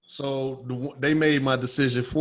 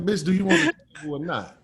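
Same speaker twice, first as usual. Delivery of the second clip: higher, louder retort; defensive snap; 6 semitones higher, 4 dB louder than usual. Retold or imitated. To the mocking buyer, a defensive snap. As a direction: push back hard.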